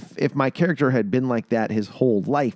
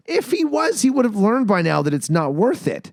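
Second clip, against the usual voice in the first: heated protest; 10 semitones higher, 5 dB louder than usual. Retold or imitated. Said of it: "I'm like".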